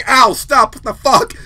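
mock pained yelling; bad scream. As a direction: act out an alarmed objection in bed. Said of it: "That's bad".

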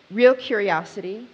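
usual speaking voice

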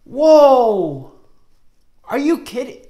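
startled outcry. Acted. Teacher shocked that words vanished. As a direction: gasp it out in playful alarm.

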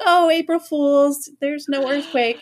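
high, drawn-out singsong reveal; teasing mock-sweet voice; imitated prankster mom. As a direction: mimic mom gleefully springing a prank. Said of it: "she calls back, and she's like".

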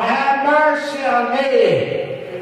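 high, slow begging cry for mercy; pleading cry. Acted. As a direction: stretch it out like a desperate plea. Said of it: "the more he began to yell".

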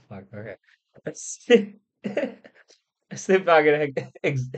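usual speaking voice

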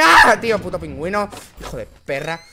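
ordinary talk

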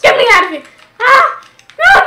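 loud, high panicked yell; panicked shout. Real, not acted. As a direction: yell to be let out. Trapped in game.

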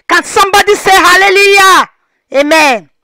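loud, high church call-out; preacher call. Real, not acted. Vlogger blessing the couple, loud preacher call-out. Calling congregation-style.

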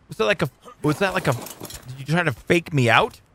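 usual speaking voice

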